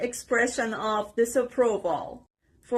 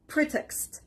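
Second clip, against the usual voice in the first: "pretext" with PRE stressed and higher; stress on first syllable; 4 semitones higher, 5 dB quieter than usual. Shown as a demonstration. Teacher showing learners where stress falls, first syllable stressed. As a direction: hit PRE in "pretext", let the second syllable drop. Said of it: "The stress is on the first part".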